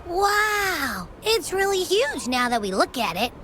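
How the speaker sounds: high-pitched, wide-eyed wonder; amazed exclamation. Acted. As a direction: let a high "wow" swoop down in awe. Companion marvels at huge sight.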